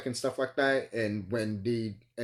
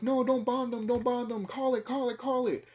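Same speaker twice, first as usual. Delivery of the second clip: pleading woman; 11 semitones higher than usual. Retold or imitated. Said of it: "She's like".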